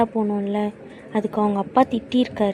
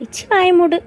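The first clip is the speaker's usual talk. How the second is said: loud, high snap; angry shut up; 7 semitones higher, 7 dB louder than usual. Acted. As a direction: slam the teaser into silence. Scolding teasing friend.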